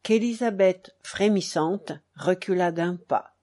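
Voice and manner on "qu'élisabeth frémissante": plain reading voice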